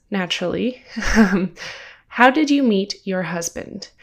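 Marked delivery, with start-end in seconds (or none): none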